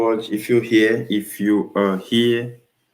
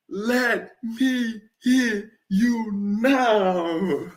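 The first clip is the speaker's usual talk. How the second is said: much higher, slow, stretched and wailing; drawn-out crowing; 9 semitones higher, much slower than usual. Real, not acted. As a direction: drag every word out in triumph. Crowing after the goal.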